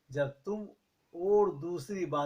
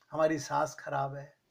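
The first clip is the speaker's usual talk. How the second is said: ordinary talk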